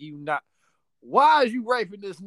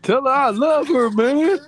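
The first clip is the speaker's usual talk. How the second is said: 1 mock crying plea; 6 semitones higher, 11 dB louder than usual.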